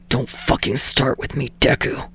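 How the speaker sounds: low, soft, venomous Bakugo; poisonously. Acted. Bakugo warning Deku off the phone, low and venomous. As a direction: drip poison on every word. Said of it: "Bakugo says poisonously".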